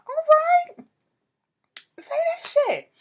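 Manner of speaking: very high, loud mock cheer; retold egging on. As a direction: hype them up in a squealed re-enactment.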